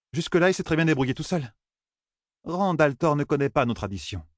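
high-pitched council member; high storybook dialogue at even volume; character in debate. Acted. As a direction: argue the point in a lifted character voice.

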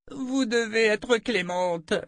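high, slow pleading man's voice; Gadi crying out. A narrator's reading. Gadi begs the Mother Confessor for mercy, high pleading cry. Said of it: "s'écria Gadi".

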